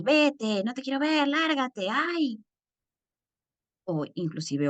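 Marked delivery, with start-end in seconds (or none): 0.0-2.4 high, drawn-out mock-angry dismissal; hammed-up angry rejection; angry outburst. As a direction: act out an exasperated send-away, pitch climbing. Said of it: "en nuestro enojo que decimos".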